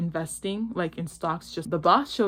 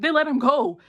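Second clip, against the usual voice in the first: normal talking voice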